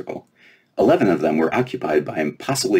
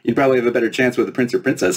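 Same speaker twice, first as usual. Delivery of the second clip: high, incredulous fairy; laughing fairy; 6 semitones higher than usual. Acted. Fairy mocking Lena's wish, high and amused. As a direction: scoff in disbelief, fast. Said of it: "burst out laughing".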